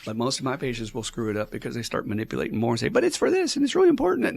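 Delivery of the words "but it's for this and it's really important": high, insistent mock whine of protest; mock pleading. Imitating patients who keep pushing, high mock pleading. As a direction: plead your case in a much higher voice.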